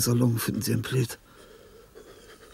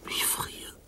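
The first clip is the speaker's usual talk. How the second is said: faint whisper; freezing whisper; 10 dB quieter than usual. Acted. Freezing old man whispering his complaint into the cold. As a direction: barely breathe it out.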